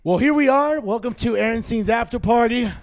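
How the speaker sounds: loud, upbeat show opener; show host intro. Acted. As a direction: hype up the show intro, drawing out names. Podcast host opening the show.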